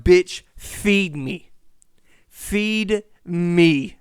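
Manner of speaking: drawn-out mock animal demand; demanding stingray voice. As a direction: voice the hungry stingray begging.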